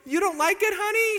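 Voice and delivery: high, sweet, innocent "honey"; faux-innocent husband. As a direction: play dumb sweetly. Husband feigning innocence to wife.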